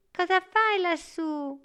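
put-on high storybook boy voice; high-pitched, wondering little boy; curious boy. A narrator's reading. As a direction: call up a question in a high child's voice. Boy questions star stuck in tree.

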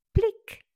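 very high, loud popping plic; high bright pop; plic pop sound. Acted. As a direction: pop it out like a bud bursting. Flower pops open.

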